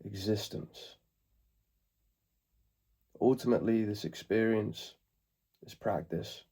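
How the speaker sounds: calm meditation guide, long pauses between phrases; guided meditation. Acted. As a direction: ease them into the present moment, unhurried.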